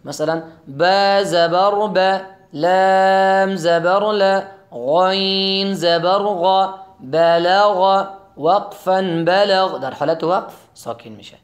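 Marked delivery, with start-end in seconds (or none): 0.8-9.8 slow, loud, syllable by syllable; slow spelling drill. Shown as a demonstration. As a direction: spell each letter out slowly, like a Quran teacher. (تلفظ آهسته و بلند حرف به حرف)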